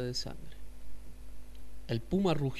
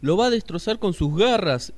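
alarmado; 6 semitones higher, 9 dB louder than usual. Acted. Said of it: "dijo el coatí".

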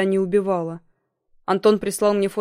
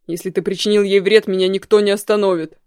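louder enraged yell; 6 dB louder than usual. Acted. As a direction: roar the threat into the phone. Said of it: "заорал в трубку".

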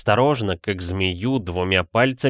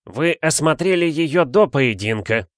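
higher-pitched, decisive rebuttal; firm objection; 6 semitones higher than usual. A narrator's reading. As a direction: contradict him resolutely. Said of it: "решительно возражал Кутюк". (решительное возражение)

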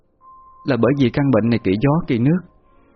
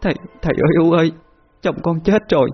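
higher, tearful daughter's wail; choked-up crying; 8 semitones higher than usual. Acted. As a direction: burst out crying to her father, voice high and choked. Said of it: "cô òa lên khóc nghẹn ngào". (giọng cao, khóc nghẹn ngào gọi cha)

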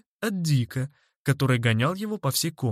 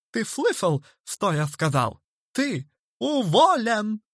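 high voice, drawn-out final dismissal; cutting retort; 8 semitones higher than usual. A narrator's reading. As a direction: rub in the sacking, feeling safe. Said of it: "отрезал мистер Хорспот". (злорадно отрезать)

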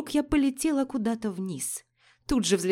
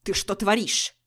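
рявкнул. Acted. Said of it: "рявкнул Стас".

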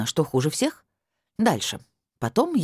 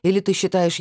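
ordinary delivery, nothing marked

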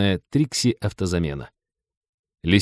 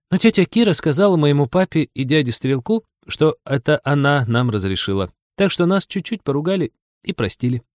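put-on high-pitched child's voice, mostly higher than narration; little girl Лиза, explaining; 7 semitones higher than usual. A narrator's reading. Лиза tells Женька they were forgiven, in a high girlish voice.

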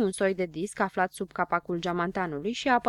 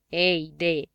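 impatient interruption, a touch louder; nerăbdător; 2 semitones lower, 5 dB louder, slower than usual. A narrator's reading. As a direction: cut in with mild impatience. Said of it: "îl întrerupse nerăbdător Istețilă". (întrerupe cu ușoară nerăbdare)

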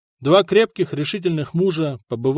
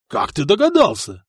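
beaming; 4 semitones higher than usual. Acted. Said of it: "просиял Сигурд".

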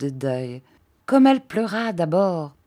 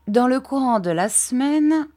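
formal aunt; 8 semitones higher, 3 dB louder than usual. A narrator's reading. Miss Clarissa lays down rules.

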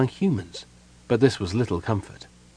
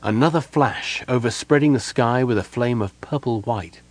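ordinary delivery

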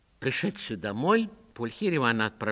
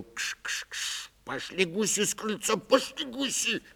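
put-on old man shooing geese; 10 semitones higher than usual. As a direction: shoo the geese off the porch.

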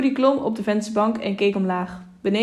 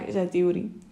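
usual speaking voice

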